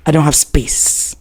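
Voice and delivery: low, slow, curt refusal; curt husband. Retold or imitated. Husband refusing the new girl, low and curt. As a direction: turn him down coolly and low. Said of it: "He just turned".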